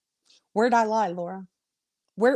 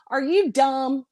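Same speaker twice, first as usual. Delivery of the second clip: normal talking voice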